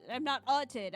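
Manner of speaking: ordinary talk, nothing marked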